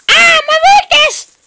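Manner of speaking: very high, loud mock-dramatic wail; hammed-up high-pitched imitation; copied cry. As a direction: squeal it out in hammed-up alarm. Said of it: "you copy them very much".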